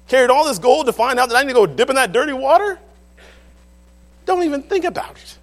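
loud, high, outraged bellow as Naaman; indignant rant. Retold or imitated. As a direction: roar it out, insulted and furious. Naaman raging at the prophet.

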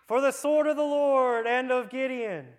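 loud, high shouted war cry; full battle cry. Shown as a demonstration. Preacher leading the trumpet moment with a loud, high battle cry. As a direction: roar it like an army charging. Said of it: "and they went".

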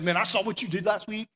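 mocking condemnation that jumps way up on burn; preachy jerk scolding a friend; judgmental jerk. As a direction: play the self-righteous jerk. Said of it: "the jerk who comes up".

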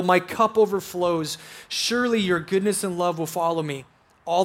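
reverent reading voice; scripture reading. Acted. Reads Psalm 23 aloud.

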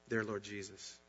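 normal talking voice

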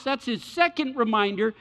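normal talking voice

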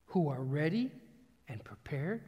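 ordinary delivery

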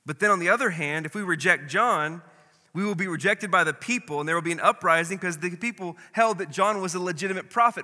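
agitated scheming. Acted. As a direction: argue like cornered leaders fearing the crowd. Leaders panic among themselves, voiced.

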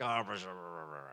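low grumbling mutter under the breath; a muttered mock grumble fading to near silence. Shown as a demonstration. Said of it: "you hear the word muttered".

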